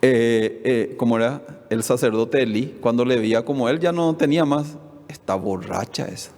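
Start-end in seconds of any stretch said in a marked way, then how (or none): 5.1-6.3 low, much softer scornful remark; scornful aside. Retold or imitated. Priest Elí scorns praying Ana, low and soft contempt.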